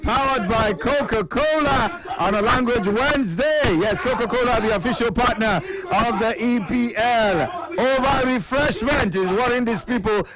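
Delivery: sponsor read. Acted. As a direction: sell the Coca-Cola plug like an announcer.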